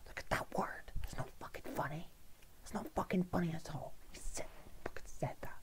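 hushed mock outrage, mostly voiceless; outraged whisper. Offended pasta lover dwelling on the insult, whispering. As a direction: whisper your scandalised disbelief.

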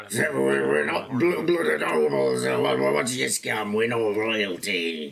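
low, halting put-on character voice; sceptical gruff rustler. Rustler questioning his partner's scam, low sceptical character voice. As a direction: drop the pitch, play a doubtful rogue.

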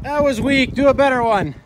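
loud, high ribbing; loud teasing. Real, not acted. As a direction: call out the weak trick. Teasing friend after trick.

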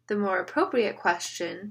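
usual speaking voice

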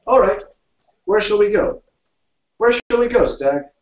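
high, loud character voice; eager storybook character, loud and high; tipsy-cheerful friend asking. A narrator's reading. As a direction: call it out to your drinking companions, pitched well up.

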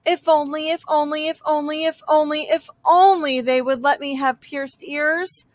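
usual speaking voice

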